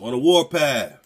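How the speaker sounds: shouting out warpath; loud interjection. Real, not acted. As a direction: boom it in over the story. Cohost chimes in loudly.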